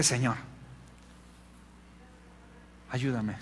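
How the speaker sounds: low, soft plea with a long pause; retold prayer. Pastor turning to God for help, hushed plea. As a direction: pray it quietly, humbly. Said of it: "Y dije".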